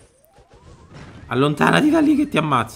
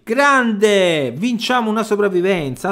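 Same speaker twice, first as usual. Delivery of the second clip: high, loud triumphant shout; victory cheer; 8 semitones higher, 6 dB louder, slower than usual. Real, not acted. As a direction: celebrate the win out loud. Celebrating victory.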